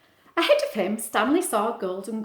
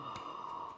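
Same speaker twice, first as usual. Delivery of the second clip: sharp gasp; 17 dB quieter than usual. Acted. Dark shape appears.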